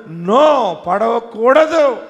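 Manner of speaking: slow, loud, firm refusal; God's loud firm answer; emphatic loud no. Acted. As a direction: declare it with full force. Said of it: "ఆయన అన్నాడు".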